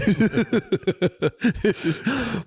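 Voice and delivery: high burst of laughter; cackling laugh. Real, not acted. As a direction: crack up at the story. Laughing at own story.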